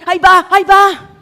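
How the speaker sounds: acting out a loud local cry of alarm; panicked outcry. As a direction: cry out in mock panic at trouble coming. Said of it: "como dicen aquí".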